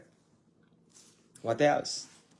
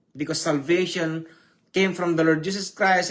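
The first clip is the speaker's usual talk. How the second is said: normal talking voice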